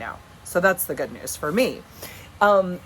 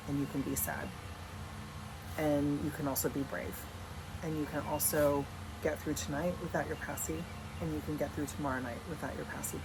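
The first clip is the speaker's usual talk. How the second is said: softer, gentle reassuring mom voice; calm a crying little boy; soothing mother; 4 semitones lower, 10 dB quieter than usual. Retold or imitated. Mother comforting her son about the pacifier, soft and reassuring.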